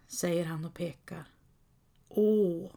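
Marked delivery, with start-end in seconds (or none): 2.1-2.7 a quiet, mild "Åh" of wonder; soft exclamation. A narrator's reading. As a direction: let out a small "Åh" at the view. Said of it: "utbrister Sancho Panza". (ett stilla förundrat "Åh")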